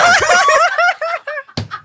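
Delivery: high squealing burst of laughter; loud shrieking laugh. Real, not acted. Laughs at own wrong answer.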